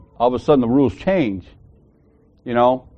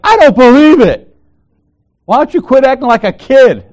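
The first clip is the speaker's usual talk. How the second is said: much higher, louder mocking scold; scolding coworker; 11 semitones higher, 8 dB louder than usual. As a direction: imitate her nagging him. Coworker scolding him, retold.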